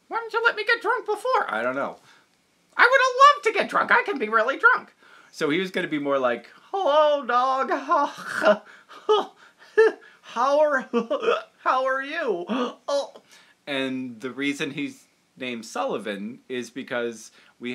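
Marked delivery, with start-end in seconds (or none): 0.1-4.9 high, loud, pleading puppet voice; whiny puppet. Acted. 6.7-13.1 high, loud, tipsy giggling dog; drunk dog voice. Shown as a demonstration. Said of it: "he was supposed to be drunk".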